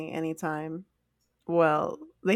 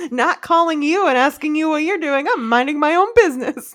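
loud, higher indignant comeback; mock retort; 7 semitones higher, 9 dB louder than usual. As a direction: snap back at the nosy question. Retorting to nosy question.

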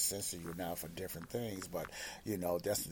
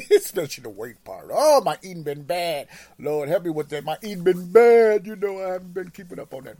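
laughing at himself; 10 semitones higher, 16 dB louder than usual. Real, not acted. Laughing about own bad eating.

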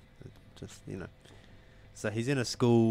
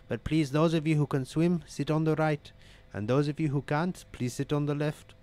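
put-on higher-pitched Indian-accented cabin announcement; 6 semitones higher, 3 dB louder than usual. Airline announcer calming passengers after engine loss, Indian accent. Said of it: "excuse me for my Indian accent".

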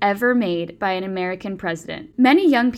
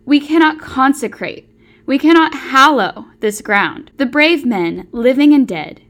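rising oratory with heavy stresses; formal oration; 5 dB louder than usual. Acted. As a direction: deliver it like Lincoln at the ceremony. Recites the Gettysburg Address.

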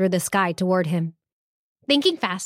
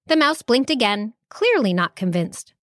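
plain reading voice

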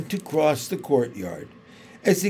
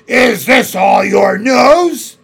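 slow, much louder cruel demand; menacing witch; 7 semitones higher, 13 dB louder, slower than usual. Acted. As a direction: loom over him with the question.

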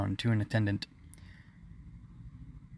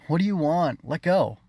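mildly exasperated, raised and louder; exasperated groan; 7 semitones higher, 5 dB louder than usual. A narrator's reading. Brother to clinging little sister, exasperated. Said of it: "He groaned".

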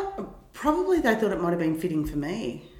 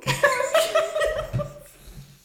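a high burst of laughter; 5 dB louder than usual. Real, not acted. Laughs at yawning joke.

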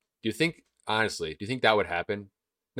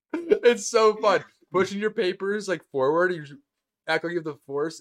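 gleeful; 9 semitones higher, 3 dB louder than usual. Real, not acted. Gleeful about faking the force.

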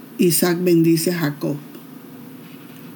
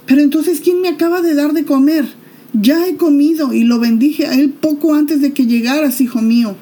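alto y agitado, sin poder creerlo; un anciano que tiembla al descubrir el engaño; estupefacto; 7 semitones higher, 3 dB louder than usual. Acted. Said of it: "Está estupefacto el hombre". (padre estupefacto pregunta a Esaú quién comió)

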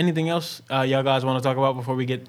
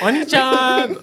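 cutesy anime voice; 8 semitones higher, slower than usual. Pretend. Anime cutesy call, laughing.

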